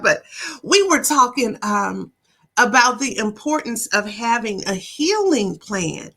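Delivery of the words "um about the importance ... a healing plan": ordinary delivery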